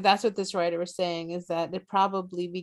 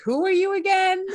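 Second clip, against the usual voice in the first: high, disdainful mock question, pitched up; snooty mock question; 11 semitones higher, 4 dB louder than usual. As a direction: play the haughty stranger who's never heard of you. Mocking a snooty European.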